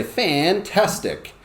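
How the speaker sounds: "Fantastic" high, in three slow beats; sing-song "Fantastic", one syllable at a time; drawn-out syllables. Shown as a demonstration. Teacher models "Fantastic" with an action, high and stretched. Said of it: "You can say".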